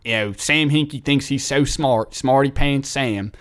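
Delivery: thick mock Philly accent; Philadelphia accent. Fan calling sports radio about Sam Hinkie, Philadelphia accent. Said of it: "this is my Philadelphia accent, so bear, bear with me".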